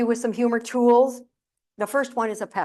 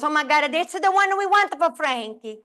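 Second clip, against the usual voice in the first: raised pitch, mock excited mother; lightly voiced excited mother; exclaiming mother; 5 semitones higher than usual. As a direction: exclaim it as Mom spotting the perfect one. Mother's outburst in funeral story.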